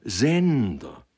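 loud, high, drawn cry of reproach; anguished, accusing call; bursting out. A narrator's reading. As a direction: cry the name as feeling breaks out. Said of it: "rief er ausbrechend".

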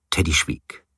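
ordinary delivery, nothing marked

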